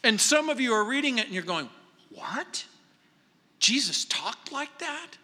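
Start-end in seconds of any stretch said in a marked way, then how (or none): 2.1-5.2 hushed, slow disbelief rising high; mock-shocked question, drawn out; incredulous reader. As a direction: imitate a puzzled reader, soft then higher.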